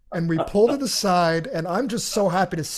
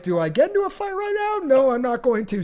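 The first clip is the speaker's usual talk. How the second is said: fast, much higher, worked up; agitated inner debate; 6 semitones higher than usual. Retold or imitated. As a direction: argue with yourself whether to fight. Deciding whether to fight.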